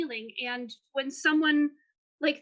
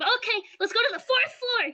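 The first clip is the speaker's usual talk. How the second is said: high, loud character voice; eager game character; 9 semitones higher, 5 dB louder than usual. Acted. Game master as Depp at the elevator, eager and high. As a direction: pipe it up eagerly, much higher and louder.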